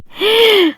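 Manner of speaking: sharp high gasp; a sudden shocked intake of breath. A narrator's reading. Bride caught out, gasping in shock. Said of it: "وشهقت وحطت ايديها على بقها".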